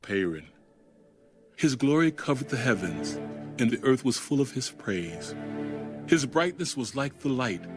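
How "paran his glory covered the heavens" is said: normal talking voice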